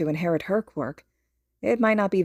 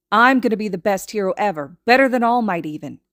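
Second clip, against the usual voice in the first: put-on loud, higher bragging child voice; boastful; 3 semitones higher, 6 dB louder than usual. A narrator's reading. Boy brags to his friend about being the best hero. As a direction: brag it proudly.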